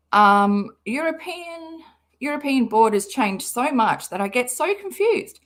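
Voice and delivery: ordinary delivery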